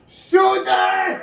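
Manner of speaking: high loud shout. Real, not acted. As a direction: yell it out. Calling for the shot.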